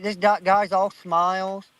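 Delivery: ordinary delivery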